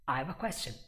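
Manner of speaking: quick contracted take; fast casual demo with "have" weakened; fourth gear. Shown as a demonstration. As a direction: squeeze the words together, fast. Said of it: "fourth gear".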